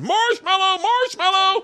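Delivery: very high panicked squeal; high desperate plea; panicked safe word. Retold or imitated. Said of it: "and I'm going".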